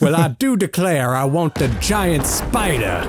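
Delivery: a pompous Southern villain demanding his toy; Southern accent, cartoonish; mock Loveless. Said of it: "is that Loveless from Wild Wild West".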